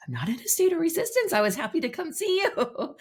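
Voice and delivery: quick, high retold objection; high-pitched mock protest. Guest retelling how she denied resistance to her friend, high mock protest.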